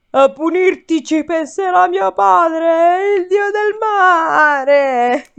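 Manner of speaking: high, loud, very slow curse; cursing giant. A narrator's reading. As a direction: drag out the threat like a furious giant. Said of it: "Polifemo ringhiò una maledizione".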